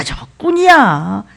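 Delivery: ordinary delivery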